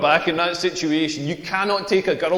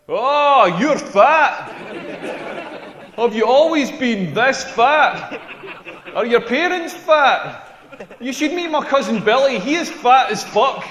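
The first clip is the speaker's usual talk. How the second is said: mocking nosy stranger; 4 semitones higher, slower than usual. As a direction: ham up a tactless busybody. Mock questions to fat person.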